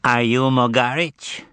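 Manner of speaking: cordial, drawn-out twang; nasal twang. A narrator's reading. Azazello questioning the man who fell, nasal twang. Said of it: "in his most cordial nasal twang".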